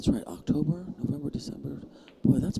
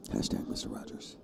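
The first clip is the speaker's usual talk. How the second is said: whispering; 9 dB quieter than usual. Real, not acted. Hushed aside.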